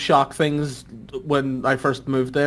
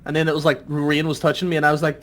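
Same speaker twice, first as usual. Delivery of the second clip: ordinary delivery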